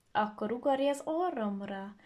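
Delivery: plain reading voice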